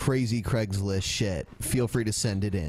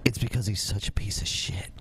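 hushed, breathy aside; whispering; 3 semitones lower, 4 dB quieter than usual. Real, not acted. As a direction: whisper it conspiratorially.

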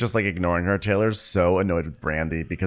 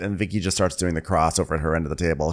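usual speaking voice

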